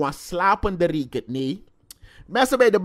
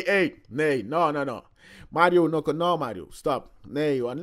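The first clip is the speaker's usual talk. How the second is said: usual speaking voice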